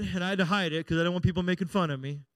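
ordinary delivery

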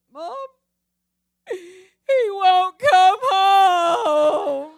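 sobbing, high and loud, drawn out slowly; crying daughter. Retold or imitated. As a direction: wail it through tears like a heartbroken daughter. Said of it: "She's bawling and squalling".

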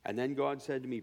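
usual speaking voice

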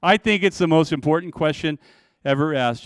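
normal talking voice

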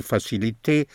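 "facilité" with final "té" sounded as "tay"; French -té ending. Shown as a demonstration. Teacher showing the French ending of English -ty words, "facilité" ending in "tay". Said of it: "all words in T are T in French".

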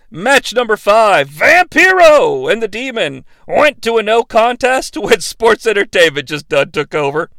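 ordinary talk, nothing marked

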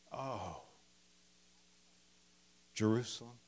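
sighing groan, soft and heavy; dramatic sigh. Shown as a demonstration. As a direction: breathe it out like a groan. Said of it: "it's a big sigh. It's a groan".

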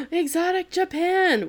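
high, slow gasping cry of wonder; gasp, then cry it out in awe; excited yell. Retold or imitated. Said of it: "and Jesus yells".

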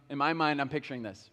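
ordinary delivery

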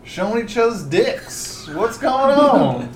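high, hammed-up southern official; southern accent. Magistrate demanding what visitors want, southern accent. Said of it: "Stop doing the southern accent".

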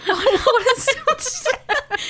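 loud, very high, drawn-out laughing question; helpless laughing. Real, not acted. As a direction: crack up while asking. Cracking up at own mispronunciation.

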